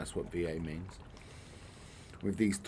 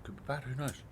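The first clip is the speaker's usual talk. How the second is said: ordinary talk, nothing marked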